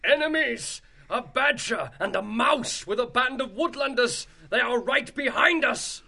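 high-pitched, urgent alarm cry; shouted warning. A narrator's reading. Fleeing rats warn their idol of enemies, shouting. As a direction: shout up the news of attackers. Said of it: "purple-robed rats shouted toward the idol".

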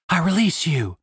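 ordinary delivery

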